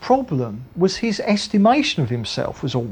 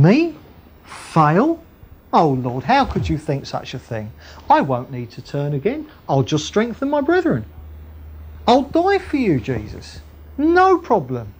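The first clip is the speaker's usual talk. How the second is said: swooping, self-assured mock indignation; overconfident protest; 2 semitones higher than usual. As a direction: play a cocksure disciple brushing off any doubt. Acting Peter's overconfident reply to Jesus.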